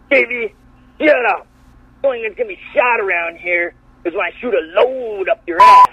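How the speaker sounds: high, forceful mock promo delivery; imitating wrestler's botched promo. Retelling a wrestler's flubbed line, hammed-up promo voice. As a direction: bellow it like an over-the-top wrestler. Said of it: "He's like".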